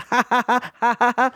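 high looping fake laugh; mock robotic laugh loop. Imitating Hillary's laughter loop, high and mechanical. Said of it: "Engage loop".

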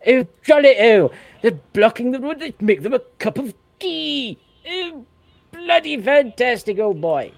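high, loud plummy English toff, hammed up; mock upper-class English gent; posh English voice. Scot mocking English police serving protesters tea, posh English voice. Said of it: "That's kind of my posh voice".

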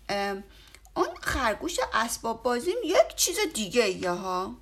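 a bear making an excuse; embarrassed bear. A narrator's reading. Bear, caught out, makes an excuse. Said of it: "خرس سرش را خاراند و گفت".

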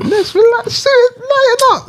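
high, stretched, slow whine; teasing the others in a squeaky drawn-out whine; mock whiny plea. Teasing the two friends arguing about Cork, in a high, slow mock whine.

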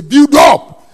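loud, drawn-out punch; sudden pulpit roar; emphatic preaching shout. Real, not acted. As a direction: belt the key word out slowly. Preacher drives key word home.

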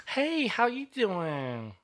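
high, drawn-out singsong greeting. Retold or imitated. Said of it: "He didn't talk like that before?".

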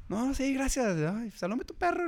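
put-on very high sweet girly voice; imitating the girl. Imitating the girl thanking him, very high and sweet.

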